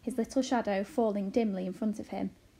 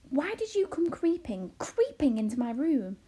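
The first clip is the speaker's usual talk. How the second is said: raised-pitch, indignant question; put-out accusation; accusing; 5 semitones higher, 4 dB louder than usual. A narrator's reading. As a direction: challenge the intruder, mildly indignant. Boy accuses the intruder.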